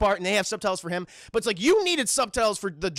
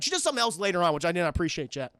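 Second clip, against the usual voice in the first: ordinary delivery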